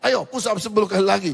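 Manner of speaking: loud, high teacher scolding, quick at the end; teacher scolding the student again; stern rebuke. Retold or imitated.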